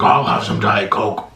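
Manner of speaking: low, slowed-down impersonation of a passenger; deep-voiced young passenger. Mimicking the young man ordering a drink, deep slow voice. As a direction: drop low and drag out the order. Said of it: "had this high little squeaky voice".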